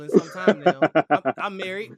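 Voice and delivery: talking through real laughter; laughing. Real, not acted. Interviewer admits he is out of the dating pool, laughing.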